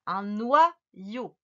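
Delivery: NOYAU slowly split noi - yau; syllable breakdown. Shown as a demonstration. Teacher reassures then repeats, slow syllabled NOYAU. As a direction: drag out NOYAU in two syllables. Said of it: "tu écris".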